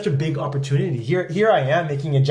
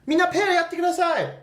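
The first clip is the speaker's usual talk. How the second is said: much higher bright call to the class; teacher telling students to pair up, higher; sensei calling class. Retold or imitated. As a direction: call out to the whole room like a teacher. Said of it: "And then".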